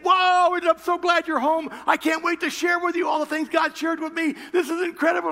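high, loud joyful gush; excited wife. Retold or imitated. Cindy thrilled to share God's words with her husband. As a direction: burst with delight.

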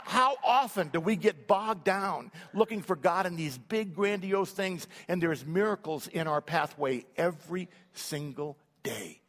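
ordinary talk

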